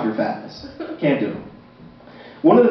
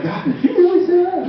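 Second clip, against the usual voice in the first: much higher, appalled question; shocked listener; 14 semitones higher than usual. Retold or imitated. An audience member appalled at the dark joke, much higher. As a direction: gasp it out in disbelief. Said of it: "you're like".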